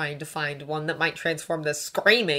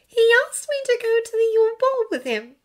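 plain reading voice

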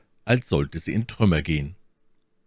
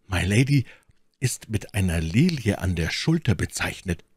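plain reading voice

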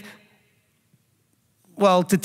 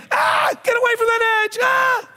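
put-on high-pitched panicked scream at a child; shrieked warning, far higher than usual. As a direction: scream it like an alarmed, overreacting parent.

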